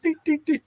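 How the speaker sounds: very high, light chime noise; ping each ding up high like a bell; high-pitched ding sound effect; imitated rising dings. Imitates careers climbing.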